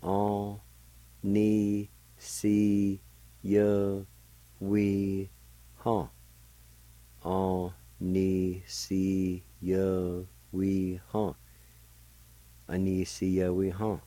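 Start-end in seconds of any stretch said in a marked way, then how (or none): 0.0-6.1 each syllable drawn out and held level, a pause after each. Shown as a demonstration. Teacher showing listeners the word for "each", syllable by syllable. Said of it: "it's pronounced like this". 7.2-11.3 sustained, evenly spaced syllables with short gaps. Shown as a demonstration. Teacher repeating the word for learners, syllable by syllable. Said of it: "it's pronounced like this".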